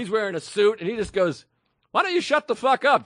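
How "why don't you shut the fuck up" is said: irritated telling off. Retold or imitated. Bystander tells customer off.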